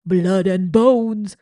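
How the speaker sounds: loud, slow hungry roar; shout. A narrator's reading. Another rat roaring for blood and bones. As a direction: bellow it greedily. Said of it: "shouted another".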